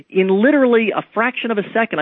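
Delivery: ordinary talk, nothing marked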